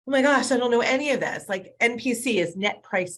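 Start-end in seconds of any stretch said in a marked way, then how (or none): 0.1-1.5 higher, louder, quick mock dismay; acted-out "I'm lost" outburst; retold overwhelmed reaction. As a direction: blurt it out as if swamped. Retells reaction to unfamiliar acronyms.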